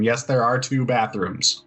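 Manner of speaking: ordinary talk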